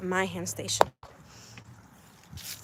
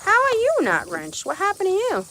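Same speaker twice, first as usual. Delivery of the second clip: pet talk; 10 semitones higher, 5 dB louder than usual. Real, not acted. Talking to a chicken.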